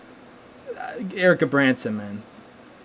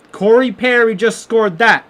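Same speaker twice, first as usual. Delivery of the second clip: higher, louder, slow drawn-out call of the scorer; mock goal call; 6 semitones higher, 4 dB louder than usual. Streamer mimics the TV announcer calling the goal, mock excited. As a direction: do the TV play-by-play man calling the goal, dragged out.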